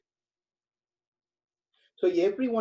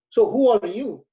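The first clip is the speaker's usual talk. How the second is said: king asking, a bit higher and louder; curious king; 5 semitones higher, 6 dB louder than usual. Acted. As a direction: press the stranger as an amazed king. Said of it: "He said".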